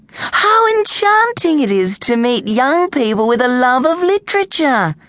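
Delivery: high-pitched, enchanted librarian; gushing. A narrator's reading. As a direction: gush with delight, way up high. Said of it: "she gushed".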